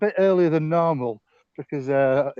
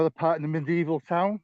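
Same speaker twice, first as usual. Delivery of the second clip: ordinary delivery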